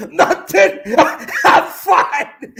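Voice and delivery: laughing high-pitched denial; high, giggly delivery. Acted. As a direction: play it giddy and unable to stop laughing. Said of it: "I'm gonna play my role a little bit differently".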